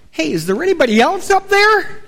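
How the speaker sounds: acted comic call upward; calling for someone else. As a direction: call up past the Lord for another option. Man calls up for someone else.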